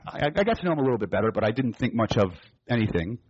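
ordinary delivery

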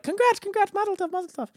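very high, squeaky well-wishers; mock congratulating crowd. Crowd congratulating him on the sewing machine, mock high-pitched cheering. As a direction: imitate a crowd in a high falsetto chirp.

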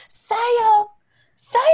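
high southern "Sam" drawn into two syllables; southern gator call. Retold or imitated. Coworker calling the gator Sam, high southern call. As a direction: call "Sam" like a Louisiana lady over the swamp. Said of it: "that southern two-syllable".

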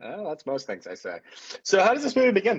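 ordinary talk, nothing marked

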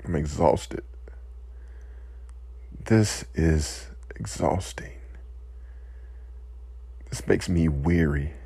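quiet, choked-up words fading to breath; emotional. Real, not acted. Speaker overcome by grief for victims, quiet and choked. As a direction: hold back tears as the voice gives out. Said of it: "I'm becoming emotional".

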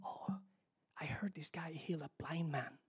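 hushed gossip on the side; low, secretive whispering; talking aside. Acted. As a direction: whisper it like a rumour passed along. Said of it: "they start talking in the side".

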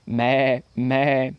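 long, held goat bleat. A narrator's reading. Goat claiming to be full, bleating.

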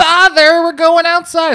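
put-on very high, loud eerie child; creepy child voice. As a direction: call out like a creepy kid. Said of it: "It's like".

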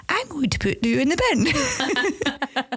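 imitated child's pretend telling-off, slow; role-play threat. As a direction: draw it out like a kid play-acting a warning.

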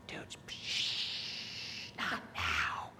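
whispered shushing under the breath; urgent hush. Retold or imitated. Tim hushes Jared in front of friends, whispered shushing. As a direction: whisper it so the others won't hear. Hushing friend among embarrassed company.

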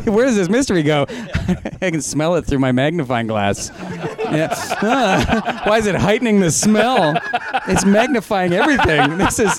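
high, laughing sleuth voice; laughing detective sniffing the clue; mock detective. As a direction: play the bumbling detective with the magnifying glass. Plays a detective sniffing clues.

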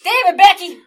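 exasperated holler, high and very loud; hammed-up scolding shout; mock parental yell. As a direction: bellow it at a misbehaving child. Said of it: "it's perfect to yell".

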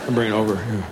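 low, soft, worn-out reply; weary patient dad. Acted. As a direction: answer a screaming child very quietly. Weary dad answers screaming child.

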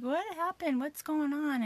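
put-on worried kids asking. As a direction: ask like a worried little boy. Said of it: "my boys were like".

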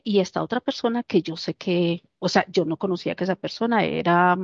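ordinary delivery

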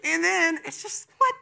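high, bewildered "what?" in mock disbelief; playing at being baffled. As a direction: pitch jumps up in comic confusion. Imagining his own reaction.

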